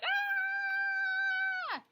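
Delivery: very high, sustained "aaah"; long scream. Acted. Showing how baby Ollie looks, long high scream. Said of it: "even Ollie looks like".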